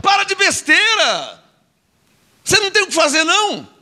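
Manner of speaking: exasperated scolding. Real, not acted. Preacher telling name quibblers to stop, exasperated. Scolding listeners over petty dispute.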